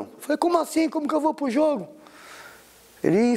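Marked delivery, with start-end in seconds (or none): 0.4-1.9 high-pitched, puzzled questioning; acted bewilderment, pitched way up; retold disbelief. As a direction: ask it in high, quick disbelief. Retold disbelief at sudden offer.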